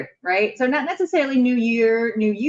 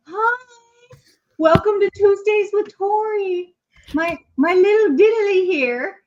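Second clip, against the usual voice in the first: high, slow put-on dog voice; 13 semitones higher, 3 dB louder, slower than usual. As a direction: speak as the dog, cartoonishly high. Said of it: "I'll do it in my dog voice".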